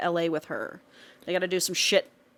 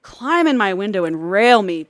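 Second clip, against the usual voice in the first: loud, hammed-up begging; mock pleading; 9 dB louder than usual. Host begging an imagined lover at the window, mock pleading. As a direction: call it out like a playful demand.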